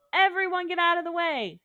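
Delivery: urgent shouted order; paramedic shouting. Acted. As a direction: call it out loudly to clear a crowd. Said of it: "shouted the paramedic".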